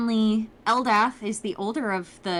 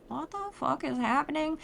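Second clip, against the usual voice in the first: bewildered aside; 6 semitones higher, 5 dB quieter than usual. Retold or imitated. Nerys stunned at the family table.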